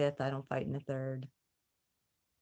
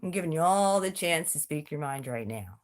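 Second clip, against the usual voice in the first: ordinary talk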